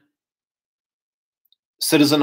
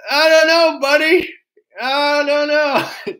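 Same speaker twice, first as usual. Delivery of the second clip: much higher, put-on voice, slow on the last I don't know; mocking bewildered voice; 12 semitones higher, 3 dB louder, slower than usual. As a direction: play a clueless guy shrugging it off. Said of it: "everyone would be like".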